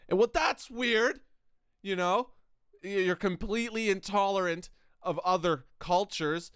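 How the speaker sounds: mock-baffled retort; acted pointed disbelief. Retelling his challenge to the American.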